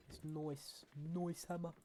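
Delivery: "nice" drawn into "noyce", repeated; "nice" rhymed with "voice", jokingly; playful "noice". Pretend. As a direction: relish "nice" as "noyce", pitch lifting. Playful repeat, vowel exaggerated.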